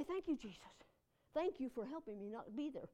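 soft, low murmur of thanks; hushed, understated gratitude; quiet thankful prayer. Acted. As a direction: give thanks quietly, as if praying privately. Acts out grateful prayer while driving.

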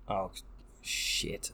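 breathy whispered swear; whispered curse. Real, not acted. Whispered curse after the drink.

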